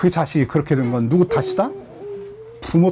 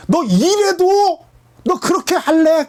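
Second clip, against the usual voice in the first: pleading God; 9 semitones higher, slower than usual. Retold or imitated. God pleading with wayward child.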